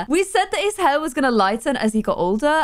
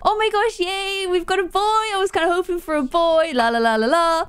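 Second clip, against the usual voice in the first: imitating past excitement, higher pitched; mock hyped; 6 semitones higher than usual. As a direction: replay the giddy baby joy in a lightly put-on voice. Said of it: "we were all hyped being like".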